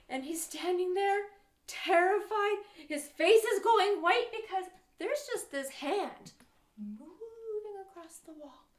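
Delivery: high, loud panicked storytelling; hammed-up fright for young listeners; dramatised terror. A narrator's reading. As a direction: tell it wide-eyed, voice leaping up. Acts out the king's terror.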